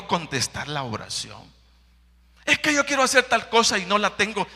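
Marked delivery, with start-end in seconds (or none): 2.4-4.5 high, fast, loud whine; whiny excuse. Acted. Preacher mimics a believer complaining he lacks things, fast whine.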